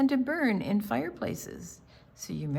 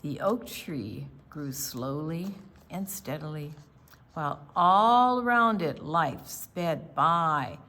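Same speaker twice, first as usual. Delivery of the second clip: clear, steady storybook reading, a bit louder; story narration; 5 dB louder than usual. A narrator's reading. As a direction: read the picture book to the class. Read-aloud story narration.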